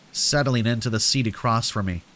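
ordinary delivery, nothing marked

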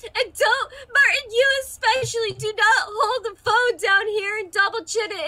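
loud, high wailing telling-off; tearful scolding. Acted. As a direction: scold through sobs, in character. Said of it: "Kuriyami cries".

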